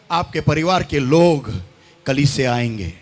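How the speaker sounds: normal talking voice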